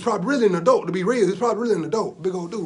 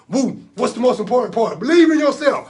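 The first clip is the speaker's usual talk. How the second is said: loud, high rousing cheer; fired-up coaching; 4 semitones higher, 6 dB louder than usual. Acted. Uncle coaching nephew to believe in himself, fired up. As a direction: drive the lesson home at a yell.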